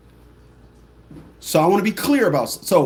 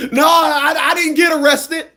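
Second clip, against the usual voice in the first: higher, louder indignant whine; high whiny protest; 9 semitones higher, 4 dB louder than usual. Retold or imitated. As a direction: protest shrilly as the client. Mocked client protest.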